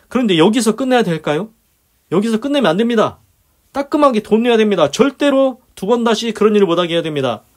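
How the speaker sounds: rising preaching. Acted. Preacher presses the point.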